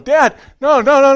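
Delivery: high, loud pleading; a child begging dad to stop; panicked daughter. Retold or imitated. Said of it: "my daughter's going".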